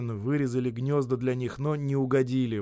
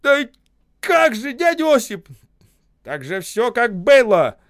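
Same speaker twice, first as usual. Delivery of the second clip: loud protest; 11 semitones higher, 11 dB louder than usual. A narrator's reading. Worker protests, shouting.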